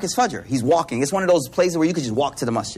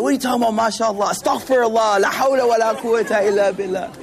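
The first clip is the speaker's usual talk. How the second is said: mock outburst, raised pitch; losing it; 4 semitones higher than usual. Mugged uncle rebukes the convert, imitated exasperated outburst. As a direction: rattle off an exasperated imitated protest. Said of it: "He lost it in the moment".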